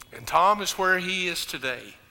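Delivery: loud, high, impassioned funeral preaching; preaching at full force. Acted. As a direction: build to a roaring climax over the music. Preaches funeral climax over music.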